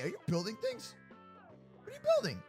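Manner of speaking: ordinary talk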